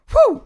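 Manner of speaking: very high loud whoop; excited whoop. Real, not acted. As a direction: whoop out in joy. Sudden thrill in game.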